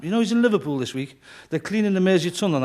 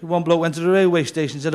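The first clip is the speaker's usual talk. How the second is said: usual speaking voice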